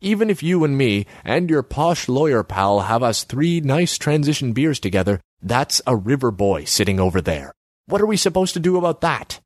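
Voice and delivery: lightly voiced gangster, ending in a high complaint; gangster character. A narrator's reading. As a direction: argue like a doubtful gangster. Gangster challenges Borislav.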